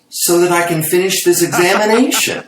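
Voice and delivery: exasperated doctor punchline. Acted. Doctor delivers the joke's punchline.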